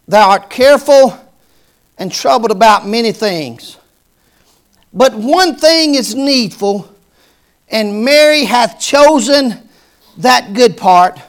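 solemn, loud scripture voice; a touch of the Lord speaking. Acted. Lord rebuking Martha, solemn and loud. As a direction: read the Lord's words with weight. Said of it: "And the Lord said".

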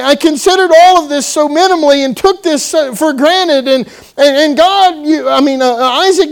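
high, loud, stumbling tearful begging; crying plea. Retold or imitated. Esau begs Isaac for any blessing, crying. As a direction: plead through tears, words tumbling. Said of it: "he's crying and tears are flowing".

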